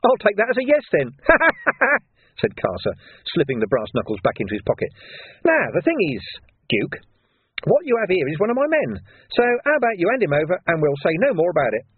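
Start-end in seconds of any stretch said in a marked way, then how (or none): 0.0-2.4 higher, louder taunting line with a laugh; sneering laugh. A narrator's reading. Carcer's voice. 5.4-11.8 higher, sneering villain voice; sly thug Carcer. A narrator's reading.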